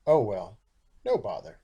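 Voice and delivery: plain reading voice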